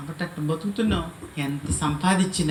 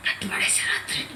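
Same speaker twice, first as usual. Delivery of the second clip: secretive whisper, no voice; whispering the plot to his aunt; 3 dB quieter than usual. Retold or imitated. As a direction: hush it like a secret plan.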